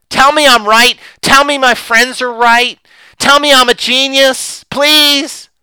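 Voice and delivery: high, loud mock outburst; exasperated shouted plea. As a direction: shout them down, then plead loudly for approval. Acted economist refusing to listen.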